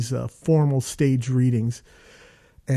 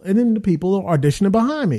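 normal talking voice

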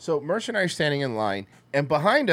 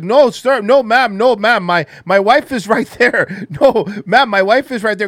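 flustered denial; 7 semitones higher, 10 dB louder than usual. Retold or imitated. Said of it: "And he's like".

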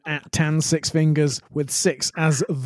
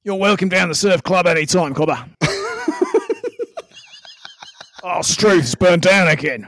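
hammed-up Aussie surf club local; matey, exaggerated Australian slang delivery; Australian accent. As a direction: lay on a broad Australian accent. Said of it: "does the best Australian accent".

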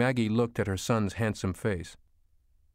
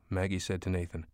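ordinary delivery, nothing marked

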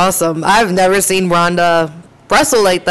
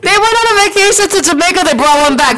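put-on Jamaican accent; 9 semitones higher than usual. Mock Jamaican voice about braids.

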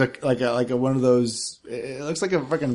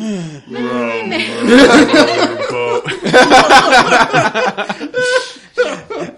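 loud laugh, much higher; 13 semitones higher, 16 dB louder than usual. Real, not acted. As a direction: crack up laughing. Reaction to the singing.

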